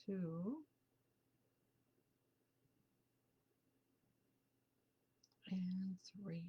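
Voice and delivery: soft, slow count, fading to a whisper; gentle breath count; guided breathing count. Real, not acted. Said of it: "Let's breathe together".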